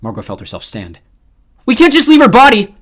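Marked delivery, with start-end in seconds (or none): 1.6-2.7 high, loud plea; desperate shout. Acted. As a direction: shout in panic. Margot pleads in panic.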